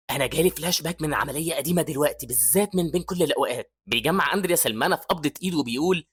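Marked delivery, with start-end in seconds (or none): none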